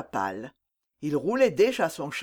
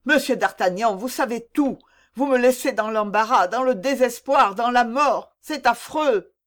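raised, higher-pitched anguished appeal; desperate outburst; 4 semitones higher, 3 dB louder than usual. Acted. Young lover reproaching the captain for withholding, desperate outburst. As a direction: build the list in high, insistent despair.

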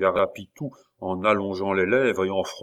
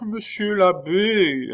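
higher, slow, begging; insisting plea; 10 semitones higher, 3 dB louder, slower than usual. A narrator's reading. Pitou insisting to his master. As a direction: insist gently. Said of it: "insista le malheureux Pitou".

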